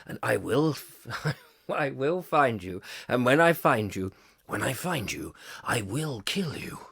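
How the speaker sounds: hushed deep movie-hero threat; film tough-guy quote. Acted. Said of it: "What does he say?".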